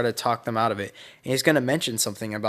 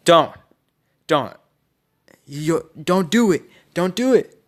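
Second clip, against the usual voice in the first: high, loud, desperate pleading yells; acted frantic shout in broken bursts; yelling; 5 semitones higher, 7 dB louder than usual. Haku begging Naruto not to kill, yelling. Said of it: "yelling at Naruto".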